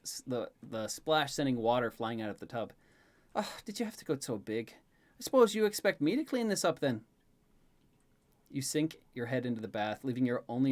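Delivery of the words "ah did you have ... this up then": sighing start, then higher and lilting; mock-grumbling Elaine. Elaine facing the splashed water, mock grumbling. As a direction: grumble playfully at the mess.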